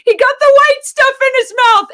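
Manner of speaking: put-on high, loud panicked kid's shout; retold child's yell. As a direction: shriek it like the frantic seven-year-old. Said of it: "my seven-year-old is yelling".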